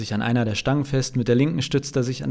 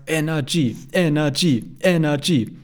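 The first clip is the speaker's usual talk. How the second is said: high, loud chant in time; rhythmic group bawling; 4 semitones higher, 4 dB louder, slower than usual. A narrator's reading. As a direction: chant it like a rowdy crowd. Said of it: "grölt die ganze Gruppe im Takt". (im Takt gegröltes Anfeuern)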